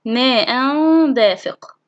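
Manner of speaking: very slow ماء دافق with long hum; tanween ikhfa. Shown as a demonstration. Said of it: "أما مع حالة التنوين". (إخفاء التنوين بغنّة طويلة في ماء دافق)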